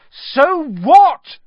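put-on angry boy shouting at friends. A narrator's reading. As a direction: fling it back at them. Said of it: "Harry shouted".

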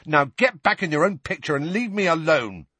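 high, exasperated dismissal; furious. A narrator's reading. Boy ordering portrait back to its frame, furious. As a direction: send the pest packing angrily. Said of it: "said Ron furiously".

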